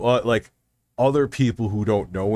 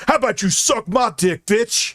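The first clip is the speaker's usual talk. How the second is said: retold angry yell; 10 semitones higher than usual. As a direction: throw the insult back hard. Scammer's insult retold.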